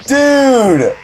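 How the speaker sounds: high, loud squeal into a laugh; laughing excited cry; joyful squeal. Retold or imitated. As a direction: squeal with delight. Reliving joy at the praise.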